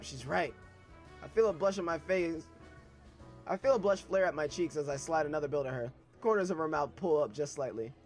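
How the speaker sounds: ordinary delivery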